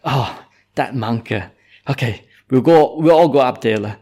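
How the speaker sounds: loud, high outburst of indignant parents; rally the village in a fury, loud; angry villagers. Acted. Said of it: "and the parents got so angry".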